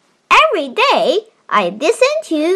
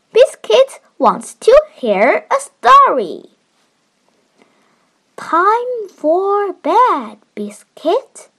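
put-on reading to a toddler; 5 semitones higher than usual. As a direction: read it gently to a little one. Child reads picture book aloud.

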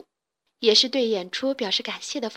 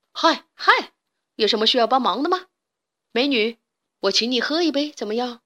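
showy chat-up voice, rushed in the middle; trying to look cool; cocky flirt. Acted. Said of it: "摆出很帅的样子问道". (装帅搭讪)

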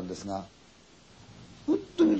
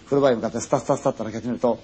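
plain reading voice

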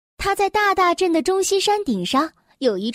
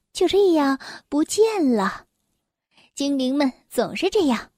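plain reading voice

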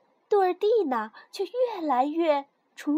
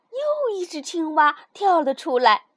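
ordinary delivery, nothing marked

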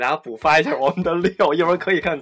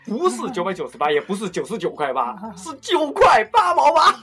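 TV shopping host; 5 semitones higher than usual. Pretend. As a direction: sell it like a TV shopping ad. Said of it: "跟他妈电视购物似的弄的".

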